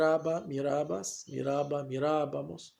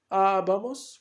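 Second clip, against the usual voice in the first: "abamos" with the first A drawn out and raised; stress on first A; 4 semitones higher, 5 dB louder, much slower than usual. Shown as a demonstration. Teacher dictating the ending with accent on the first A, "abamos" stressed up front. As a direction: hit the opening A of "abamos", louder and higher. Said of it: "con acento sobre la primera A".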